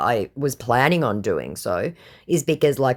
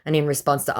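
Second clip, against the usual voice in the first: ordinary talk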